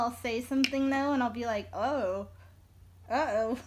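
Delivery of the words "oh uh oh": put-on a quick Southern-accented slip; thick regional accent, lightly done. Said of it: "that had a really thick accent".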